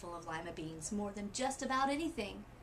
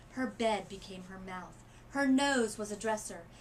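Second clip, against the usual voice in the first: usual speaking voice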